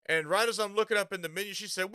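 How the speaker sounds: ordinary talk, nothing marked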